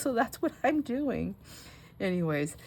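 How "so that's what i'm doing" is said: put-on matter-of-fact kid. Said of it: "He goes".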